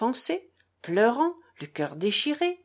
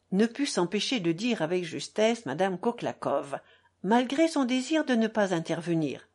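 plain reading voice

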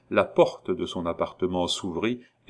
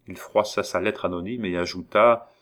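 plain reading voice

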